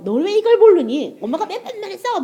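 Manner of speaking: put-on mother yelling at her little child, half laughing; mother scolding child. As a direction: imitate a mom losing her patience with her kid. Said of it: "애한테도 막 화를 내면서".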